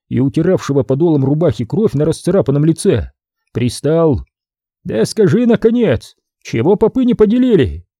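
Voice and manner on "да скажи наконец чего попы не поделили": pestering. A narrator's reading. Helmsman pesters.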